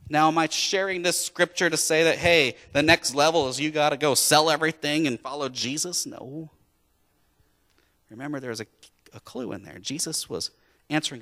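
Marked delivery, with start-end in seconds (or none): none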